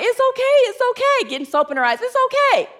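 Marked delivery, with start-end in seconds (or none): 0.0-1.3 much higher, gently soothing; soothing sister. Retold or imitated. Sister consoling her weeping hairstylist, high soothing coo. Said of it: "My sister's like". 2.0-2.7 much higher, gently soothing; soothing sister. Retold or imitated. Sister consoling her weeping hairstylist, high soothing coo. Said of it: "My sister's like".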